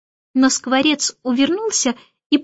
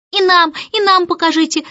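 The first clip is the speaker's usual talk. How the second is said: higher-pitched eager twins; noisy clamouring; 6 semitones higher, 4 dB louder than usual. A narrator's reading. Twins demanding to be shown too, noisy clamouring. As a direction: clamour to be included. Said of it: "зашумели близнецы".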